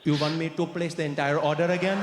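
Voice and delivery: put-on stiffly incredulous in an Indian accent. Customer caught out by the waiter, Indian accent. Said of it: "You got a bit of an accent".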